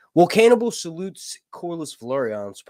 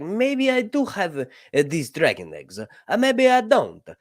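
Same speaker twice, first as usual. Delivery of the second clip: high, slow, mock Italian Mario accent; hammed-up Italian Mario accent, drawn out; 5 semitones higher, 3 dB louder, slower than usual. Sea Lord taunting the Hand in a mock Italian Mario accent. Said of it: "doing the Mario voice".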